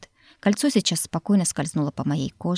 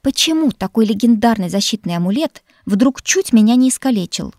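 higher, louder indignant question; 4 semitones higher, 7 dB louder than usual. Acted. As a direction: demand it in outrage. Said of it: "не пытаясь скрыть негодование". (с возмущением)

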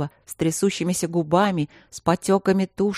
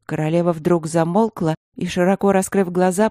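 plain reading voice

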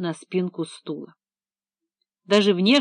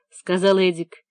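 ordinary delivery

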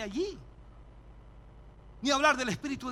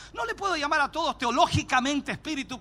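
ordinary talk, nothing marked

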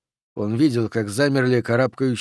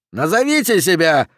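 higher, raised commanding yell; 5 semitones higher, 4 dB louder than usual. Acted. Captain challenges the silent climbers, yelling. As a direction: demand an answer at raised volume. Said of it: "снова завопил капитан".

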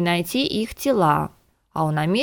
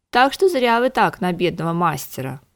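usual speaking voice

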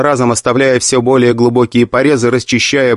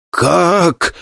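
hoarse wheeze; 7 semitones higher than usual. Acted. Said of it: "захрипел настоящий Гуйшен".

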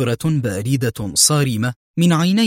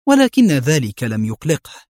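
ordinary delivery

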